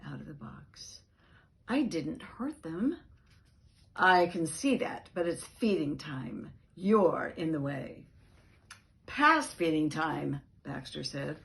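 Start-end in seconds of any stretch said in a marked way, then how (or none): 1.7-3.0 higher, pleading voice; high defensive plea. Acted. Dog pleading his innocence to the cat, pitched high. 3.9-8.0 louder, firm reproach; firm scolding. Acted. Cat scolding the dog for blocking feeding, loudly. 9.1-10.4 slow, pointed, louder retort; angry retort. Acted. Dog retorting to the cat, angrily. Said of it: "remembering his anger".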